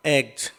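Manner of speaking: "एग्ज" closing on a voiced z/j; Z sound at the end. Shown as a demonstration. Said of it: "अंत में जय आएगा". ("एग्ज" के अंत में ज की आवाज़)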